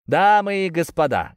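loud, high call through a megaphone; megaphone shout. Acted. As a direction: hail the crowd through a horn. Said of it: "крикнул в рупор Чезаре".

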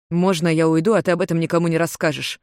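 ordinary delivery

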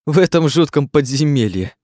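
high whimpering complaint; tearful. A narrator's reading. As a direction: carry the sob through the words. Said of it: "снова всхлипнул Жером".

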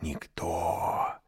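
slow, hushed serpent voice; sly snake whisper. A narrator's reading. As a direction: lure the child softly. Snake coaxes the lone boy.

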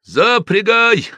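high, loud, slow bellow at the coachman; shouted order. A narrator's reading. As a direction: yell the command. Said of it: "закричал Евпатий". (крик кучеру)

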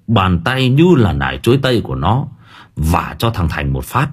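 usual speaking voice

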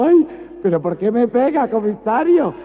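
quejido muy agudo y fuerte; llanto fingido. Acted. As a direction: lloriquea exagerado. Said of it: "el maricón llorando". (lloriqueo amanerado muy alto)

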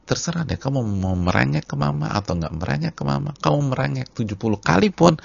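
firm mother. Acted. Mother answering her child's whining, emphatic refusal. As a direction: act out a mother who will not budge. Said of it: "Terus kata ibunya".

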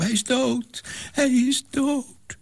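put-on high, anguished girl's cry; screaming. A narrator's reading. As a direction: cry out that he is dead. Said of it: "schreeuwde ze". (hoge, wanhopige kreet)

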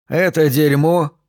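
slow, shuddering mock disgust; hammed-up revulsion; theatrical disgust. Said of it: "театрально содрогнувшись, продолжил игру Смитбек".

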